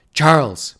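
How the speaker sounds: sharp, loud call of a name; a wife's sharp rebuke of her husband; sharp hissed name. A narrator's reading. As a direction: snap his name at him. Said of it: "my mother hissed".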